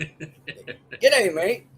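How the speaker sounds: laughing mock Australian greeting; Australian accent. Teasing about daughters speaking Australian, mock Australian accent. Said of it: "and Australian".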